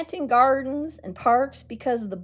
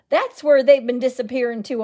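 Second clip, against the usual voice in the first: louder sharp accusing snap; snapping; 7 dB louder than usual. Acted. Mean old woman accusing the children, snapped loud. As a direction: bite it off, accusing. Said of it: "a mean-looking old woman snapped".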